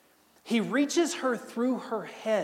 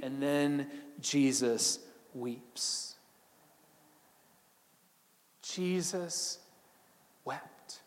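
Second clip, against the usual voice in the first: slow, much softer, fading to a whisper; hushed and slow; 5 semitones lower, 8 dB quieter, much slower than usual. Acted. Preacher telling how Jesus wept, hushed and slow. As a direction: let the words sink into near silence. Solemn climax of Jesus weeping.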